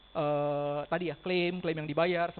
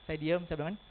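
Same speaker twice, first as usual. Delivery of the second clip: ordinary talk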